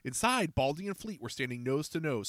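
ordinary delivery, nothing marked

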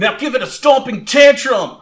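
high, loud trainer's order; battle command. Acted. Trainer orders Sparkle to attack, calling out. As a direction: call out the next move with gusto.

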